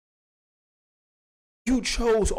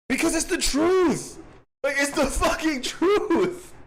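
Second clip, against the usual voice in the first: high, drawn-out cry of protest; mock outburst; 12 semitones higher, slower than usual. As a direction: wail it slowly, way up in pitch. Mock outburst about the truth.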